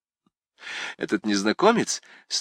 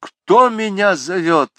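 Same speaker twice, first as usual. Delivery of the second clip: loud, high, slowed question as if just woken; startled awake; 10 semitones higher, 7 dB louder, slower than usual. A narrator's reading. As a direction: jolt out of a daze and ask. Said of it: "Как бы внезапно пробудившись от сна". (испуганно, будто проснувшись)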